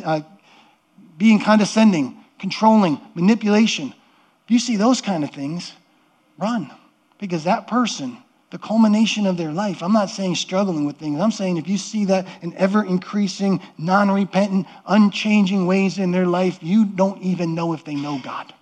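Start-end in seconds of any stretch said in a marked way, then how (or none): none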